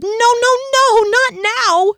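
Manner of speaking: loud, high desperate pleading. A narrator's reading. Kier begging the fading rod, desperate pleading. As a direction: beg the light not to die. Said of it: "she pleaded".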